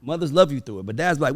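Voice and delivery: ordinary delivery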